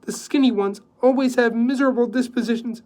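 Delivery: loud, high, sweetly catty; coy jab. A narrator's reading. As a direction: needle her with mock innocence. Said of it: "batting her eyelids".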